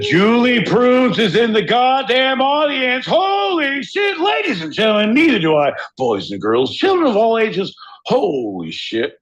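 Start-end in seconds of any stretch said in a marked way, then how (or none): 0.0-3.1 high, booming circus barker; ringmaster announcer. Pretend. 3.1-4.2 high drawn-out shout; shouted exclamation. Pretend. 4.2-7.7 high, booming circus barker; ringmaster announcer. Pretend.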